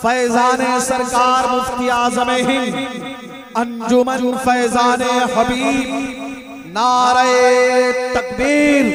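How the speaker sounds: slow, drawn-out slogan call to the crowd. Acted. Reciter leading crowd slogans, drawn-out rally call. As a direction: call out each slogan long.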